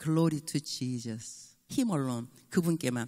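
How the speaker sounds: slow, low and soft, savouring the words; a quiet sincere blessing; preacher commending the phrase, slow and reverent. Shown as a demonstration. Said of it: "그 말이 너무 좋은 것 같아요".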